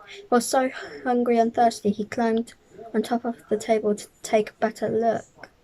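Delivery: ordinary delivery, nothing marked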